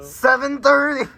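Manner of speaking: high, slow teasing yell; playful shout. Pretend. Playful countdown shouting at friend.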